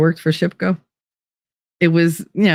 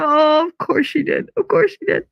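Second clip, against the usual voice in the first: mock exasperation; 9 semitones higher than usual. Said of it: "I was like".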